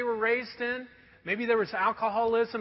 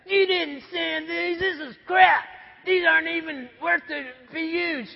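angry foreman; 6 semitones higher, 4 dB louder than usual. Retold or imitated. Preacher voicing the foreman rejecting the boards angrily. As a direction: tear into the worker's sanding. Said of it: "he goes".

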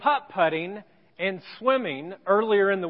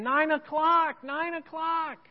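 higher, drawn-out disbelieving repeat; acted inner fretting, pitched up and fading on the repeat; exasperated muttered thought; 7 semitones higher, slower than usual. Dad seeing the room unpacked at departure time, high-pitched exasperation.

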